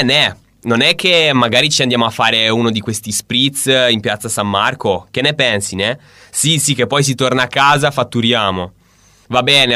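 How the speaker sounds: loud Milanese phone call; hammed-up Milanese accent, chatting to a pal. Shown as a demonstration. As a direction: imitate a brash Milan business type on the phone. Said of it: "the Mi-, Milan part".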